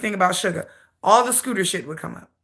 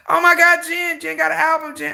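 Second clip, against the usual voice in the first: mock excitement; 7 semitones higher than usual. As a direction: overdo the joy sarcastically. Sarcastic excitement.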